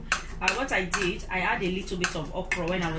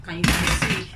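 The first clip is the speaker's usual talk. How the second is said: loud call to viewers; 8 dB louder than usual. Real, not acted. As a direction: raise the voice suddenly. Showing the pot to viewers.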